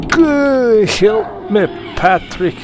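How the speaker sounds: high, loud put-on cry for help; mock yell. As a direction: shout a hammed-up plea in a high voice. Playful mock cry, louder take.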